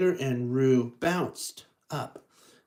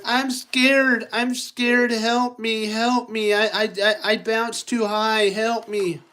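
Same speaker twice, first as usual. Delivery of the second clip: high, louder, pleading Help me; scared Tigger; 7 semitones higher, 4 dB louder than usual. Acted. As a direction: beg for help, frightened. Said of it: "Tigger's like".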